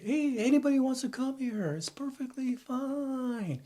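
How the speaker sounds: high, soft mock welcome in a sing-song voice; mocking naive liberal. Mocking naive liberals who welcome everyone, high mock voice. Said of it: "They say".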